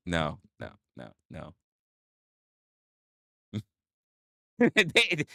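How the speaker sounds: ordinary talk, nothing marked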